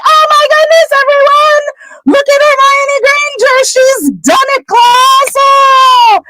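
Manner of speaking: very high squealing, delighted teacher voice; Professor Flitwick. Acted. Professor shows off a pupil's success to the class, high delighted squeal. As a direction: squeal it in falsetto like an amazed little professor. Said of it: "Professor Flitwick sees and is like".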